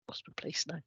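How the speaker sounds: whispered aside to self; whispering. Real, not acted. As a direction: say it under your breath. Muttered aside after losing place.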